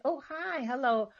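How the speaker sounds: a high, sugary "Oh, hi" that eases into "hello"; mock sweet greeting. Warning listeners about deceitful people who grin, with a mock sweet greeting. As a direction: imitate a fake friendly hello from someone with a hidden motive. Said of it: "They'll grin at you and say".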